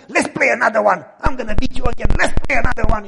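bubbling over to play again; excited. Retold or imitated. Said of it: "he was all laughing, all excited".

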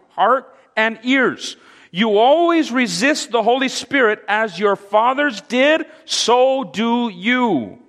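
high, forceful preacher's denunciation; bold, harsh rebuke. Acted. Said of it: "notice how bold Stephen becomes".

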